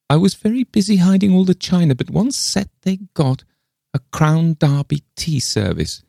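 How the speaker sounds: high, eager exclamation; excited cry. A narrator's reading. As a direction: cry it out with sudden recognition, pitched high. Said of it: "cried Martha Pym".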